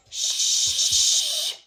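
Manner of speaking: long loud shushing sound. Shown as a demonstration. Teacher demonstrates a loud library shush. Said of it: "they say".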